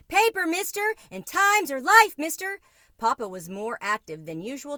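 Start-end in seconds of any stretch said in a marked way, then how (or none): 0.1-2.6 very high, loud street cry; eager vendor shout. A narrator's reading. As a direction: call out papers to passing commuters. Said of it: "eager in his shouts of".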